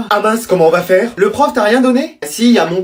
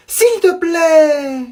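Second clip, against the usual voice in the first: very slow, higher pleading; drawn-out plea; 6 semitones higher, much slower than usual. Acted. Child begging mom for more cartoon, slow drawn-out plea. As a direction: stretch every syllable to beg.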